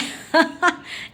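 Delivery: high, loud burst of laughter. Real, not acted. Mother laughs at her son's dance, high loud laugh.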